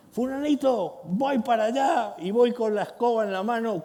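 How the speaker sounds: the parent at the end of their rope, threatening with the broom; full-blast mock rage; mock raging yell. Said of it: "cuidado, nueve".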